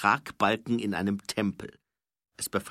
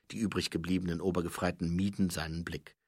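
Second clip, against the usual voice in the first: ordinary delivery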